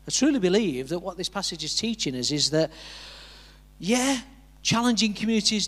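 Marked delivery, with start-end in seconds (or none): none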